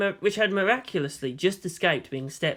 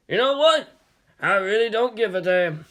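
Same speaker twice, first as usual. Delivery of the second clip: put-on higher, louder shrugging old shopkeeper; gruff dismissive shopkeeper; 5 semitones higher, 4 dB louder than usual. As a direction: wave it off, gruff and amused. Owner shrugs off question.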